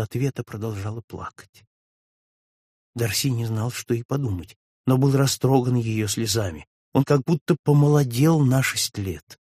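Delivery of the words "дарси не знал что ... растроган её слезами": usual speaking voice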